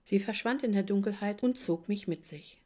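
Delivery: ordinary delivery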